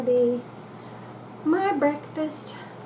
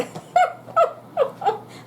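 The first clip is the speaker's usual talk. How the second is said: squealing laugh; high laughing. Real, not acted. Laughing after burn.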